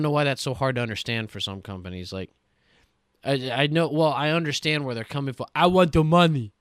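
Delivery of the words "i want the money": loud mock money-grubber voice; hammed-up greed, loud and put-on; greedy company. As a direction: demand it like a cartoonish greedy boss. Mocking greedy game companies.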